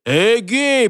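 high, urgent hey; calling out. A narrator's reading. Holger interrupting the spell, calling out. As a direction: shout to stop her, voice lifted.

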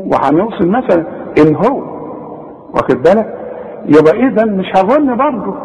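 ordinary delivery

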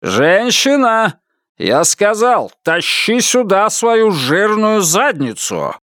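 a husband ordering his wife around, menacing; bullying order. A narrator's reading. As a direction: bark it like a tyrant.

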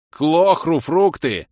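yelled. Acted. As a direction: bellow it in disgust. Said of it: "не я завопил".

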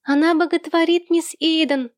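put-on high, squealing little-boy voice; high-pitched childish jeer; piercing child's cry. A narrator's reading. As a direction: shriek the teasing like a small boy. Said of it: "пронзительно вскрикнул малыш Сэм".

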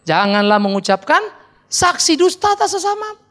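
slow, rising solemn recitation; reciting commandment. Acted. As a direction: proclaim the law. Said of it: "sepuluh hukum Taurat". (membacakan hukum dengan khidmat)

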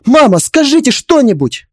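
high-pitched urgent plea; desperate appeal. Acted. Wife begging mother-in-law to speak up, desperate.

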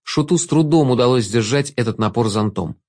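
ordinary delivery, nothing marked

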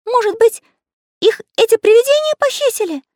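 very high, loud frightened little voice; frightened exclamation. A narrator's reading. Little artist fears ghosts took the children, exclaiming fearfully. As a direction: squeal it out in fear. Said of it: "с дрожью в голосе воскликнул Карандаш".

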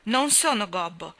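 loud, slow cry of denial; a boy crying out against the insult; angry shout. A narrator's reading. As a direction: shout it down at the old man. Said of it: "gridò Colin".

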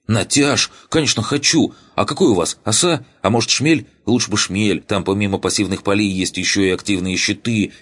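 high, bubbling with excitement; eager excitement. Acted. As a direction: gush like a thrilled kid. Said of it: "загорелись надеждой".

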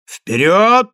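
loud, high rallying call; rally them to attack; shouted battle cry. A narrator's reading. Greeks urge each other forward, shouted battle cry. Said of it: "закричали греки".

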